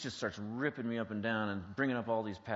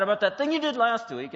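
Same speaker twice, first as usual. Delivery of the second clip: apology erupting into a loud, high yell; mock angry confession; 12 semitones higher, 11 dB louder than usual. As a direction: let the resentment burst out, hammed up. Confession turning into angry accusation.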